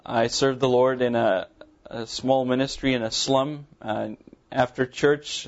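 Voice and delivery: usual speaking voice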